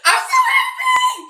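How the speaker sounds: high, loud laughing shout of happiness; real joyful laughter, shouted high. Real, not acted. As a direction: burst out laughing, overjoyed.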